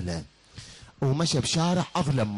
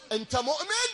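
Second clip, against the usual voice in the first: woman's voice. Acted. Woman questions the stranger.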